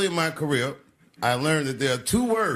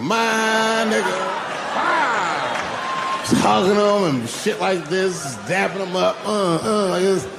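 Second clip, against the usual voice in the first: high, drawn-out hype greeting; excited greeting; 6 semitones higher, slower than usual. Retold or imitated. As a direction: greet a royal like your homeboy. Acting out greeting Prince Charles.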